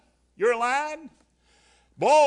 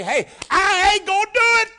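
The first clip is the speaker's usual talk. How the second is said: much higher, loud defiant holler; 13 semitones higher, 12 dB louder than usual. Retold or imitated. Preacher voicing a churchgoer's honest refusal, loud holler. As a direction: belt out the refusal. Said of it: "And you holler".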